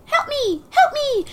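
tiny squeaky high bug cry; very high, thin pleading squeak. Retold or imitated. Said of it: "You have a great bug voice".

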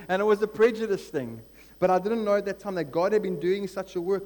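rising sermon fervour from the pulpit; fired-up preaching. Acted. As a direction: preach the story at full force.

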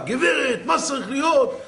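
acted exasperation, pitched high; retold fuming demand. Furious customer storms up to the office clerk, fuming. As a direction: confront the clerk in mock fury. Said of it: "עצבים אש".

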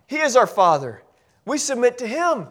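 ordinary talk, nothing marked

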